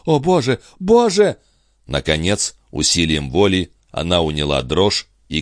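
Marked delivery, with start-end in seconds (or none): 0.0-1.4 impatient cry, pitched far up; нетерпеливый вскрик. A narrator's reading. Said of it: "она в нетерпении топнула и вскричала". (нетерпеливый возглас высоким голосом)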